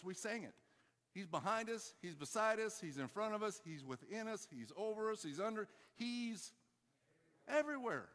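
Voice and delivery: high, driving sermon delivery; full-force preaching. Real, not acted. Preacher tells congregation God is behind us, high impassioned preaching. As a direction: build insistently through each phrase, pitched high.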